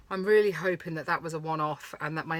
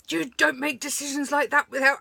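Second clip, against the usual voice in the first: louder, higher replay of an angry outburst; angry retort; 7 semitones higher, 6 dB louder than usual. Retold or imitated. Retelling a row with her husband over not stopping, loud angry retort. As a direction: snap back at him, pitched up and loud. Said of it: "I was like".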